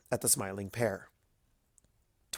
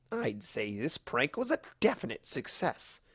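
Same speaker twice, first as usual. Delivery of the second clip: gleeful Discord; 6 dB quieter than usual. A narrator's reading. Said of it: "Discord cackled".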